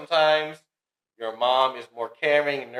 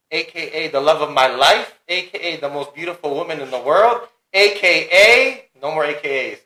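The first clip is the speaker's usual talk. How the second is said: hyped-up introduction; 2 semitones higher, 7 dB louder than usual. Real, not acted. Husband introduces wife as special guest, hyped-up announcement.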